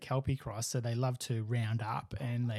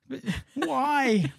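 high-pitched, hammed-up plea; mock plaintive; 12 semitones higher, 4 dB louder than usual. Owner asking the dog about the dug lawn, mock despair. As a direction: wail it helplessly, pitched way up.

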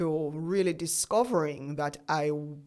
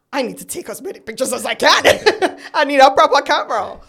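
fast, loud, laughing burst of excitement; excited rush; 8 semitones higher, 12 dB louder, faster than usual. Retold or imitated. New mother telling herself to grab a camera, excited and laughing. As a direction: gush like a thrilled new mum.

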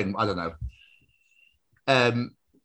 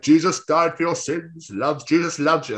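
mock preacher's proclamation, slightly raised; street preacher; 4 semitones higher than usual. Imitating man preaching outside the shop, sing-out proclaiming. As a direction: intone it like a street evangelist. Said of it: "There was a guy preaching for Jesus".

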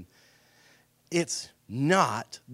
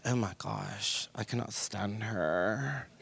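low, slow, softer gossiping jab; catty mock aside; 5 semitones lower, 9 dB quieter, slower than usual. Lady gossiping about a friend she just hugged, low and slow. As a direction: mutter it behind her back, slowly. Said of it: "she said".

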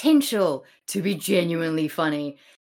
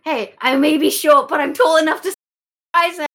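high, loud indignant protest; indignant Hermia; 8 semitones higher, 8 dB louder, faster than usual. Retold or imitated. Hermia protesting being called short, high and loud. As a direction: splutter like an insulted short girl. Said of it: "it's like".

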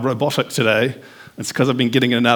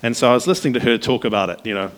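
normal talking voice

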